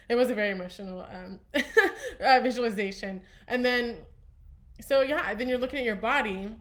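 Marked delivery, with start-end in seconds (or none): 0.1-3.2 real laughter breaking through the words; laughing emotionally. Real, not acted. Telling her viewers about the meditation, laughing through tears. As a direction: laugh through it, a little overcome. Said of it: "Oh, I'm gonna get emotional".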